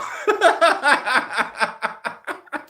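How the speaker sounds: high-pitched burst of laughter; laughing. Real, not acted. Streamer cracking up at the brothel remark, high laughing.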